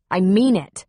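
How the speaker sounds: clipped final warning; firm snap. Acted. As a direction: lay down the law. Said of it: "she snapped".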